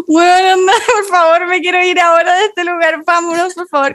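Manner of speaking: high, urgent begging to leave; mock panicked plea. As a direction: plead to get out, high and urgent. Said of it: "me dio demasiado miedo".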